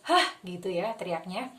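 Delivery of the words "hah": short sharp yell "Hah!"; shout. Shown as a demonstration. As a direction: throw the anger out with a yell. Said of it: "sambil teriak yang kenceng".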